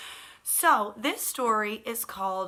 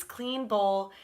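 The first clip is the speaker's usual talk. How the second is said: plain reading voice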